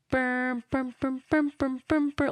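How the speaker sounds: humming the T-Rex music; imitating ominous music. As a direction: mimic the scary soundtrack with burrs. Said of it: "the music is like".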